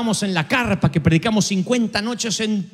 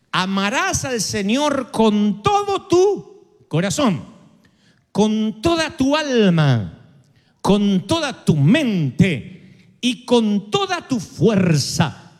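slow, weighty words, leaning on each toda; solemn scripture quote; much slower than usual. Acted. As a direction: intone the commandment slowly, phrase by phrase. Solemnly quoting Jesus' commandment.

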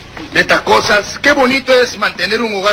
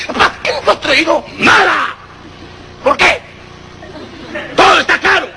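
raised, curt mock-angry exchange; mean father; 5 semitones higher than usual. Mean father refusing his rebellious child, mock-angry high replies. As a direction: rebuff the child with high, irritated shouts.

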